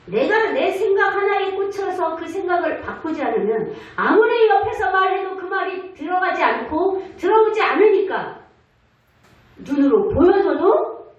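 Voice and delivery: fervent preaching. Acted. Pastor urging the congregation to let go of stubborn thoughts, preaching hard. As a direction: press the point home to the congregation.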